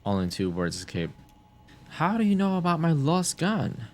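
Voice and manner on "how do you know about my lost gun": shocked. Real, not acted.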